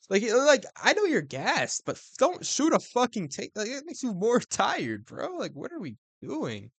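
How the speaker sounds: fed-up fan ranting, voice climbing; frustrated rant. Real, not acted. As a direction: pitch up and vent at the fighter. Ranting at a gassed fighter.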